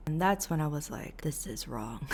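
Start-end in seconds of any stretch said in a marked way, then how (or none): 1.2-2.1 soft unvoiced whisper to herself; whispered aside. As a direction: breathe it like a secret realization. Inner alarm at the cult.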